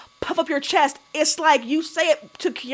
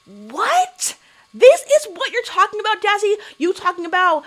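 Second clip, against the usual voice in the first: high, disbelieving challenge; incredulous; 6 semitones higher, 3 dB louder than usual. Real, not acted. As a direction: call her out in sheer disbelief. Host mocks Jazzy's claim, incredulous.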